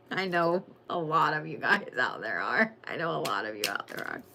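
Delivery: giggly and low. Real, not acted.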